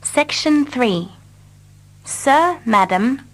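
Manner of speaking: ordinary talk, nothing marked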